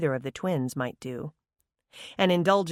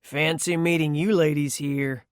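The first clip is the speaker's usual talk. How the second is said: drawling man. A narrator's reading. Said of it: "he drawled".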